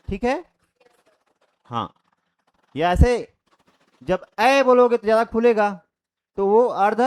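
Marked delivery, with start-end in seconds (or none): none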